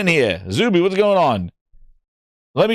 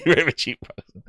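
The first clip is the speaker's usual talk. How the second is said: laughing; 2 semitones lower, 3 dB quieter than usual. Real, not acted. Laughing at a viewer comment.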